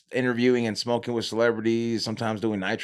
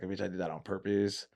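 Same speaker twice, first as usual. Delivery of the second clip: inner voice; 2 semitones lower, 10 dB quieter than usual. Retold or imitated. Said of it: "in my head".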